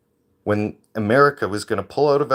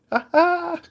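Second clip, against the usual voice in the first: sudden high mocking cackle; gloating laugh. Real, not acted. Gloating at Trudeau missing the Stampede, high mocking laugh. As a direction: crow with delight at his absence.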